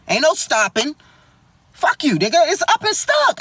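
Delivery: usual speaking voice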